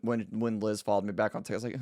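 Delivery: ordinary delivery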